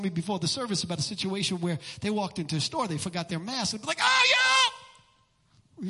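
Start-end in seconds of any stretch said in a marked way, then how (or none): 4.0-5.9 sudden high, loud cartoonish scream; exaggerated high-pitched holler; mock outraged yell. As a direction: imitate someone shrieking in overreaction. Acts out customer confronted over mask.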